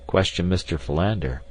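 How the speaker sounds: ordinary delivery, nothing marked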